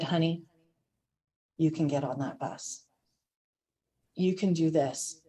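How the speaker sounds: soft, low, gentle reassurance; calm him down softly; quiet comforting mother; reassuring. Retold or imitated.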